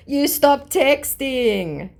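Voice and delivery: high, very slow taunt; high mocking scammer voice. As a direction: drag it out to mock him. Reading out the scammer's reply.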